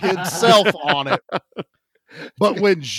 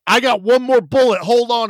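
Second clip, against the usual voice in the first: louder, higher eager announcement; mock eager; 5 semitones higher, 7 dB louder than usual. Old vet reaching for another bullet, loud and higher. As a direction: toss it off cheerfully loud. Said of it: "He goes".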